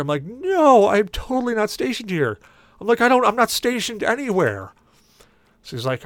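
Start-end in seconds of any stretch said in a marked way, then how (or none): 0.2-4.7 much higher insistent protest; exasperated denial. Retold or imitated. Passenger insists to cabbie he isn't military.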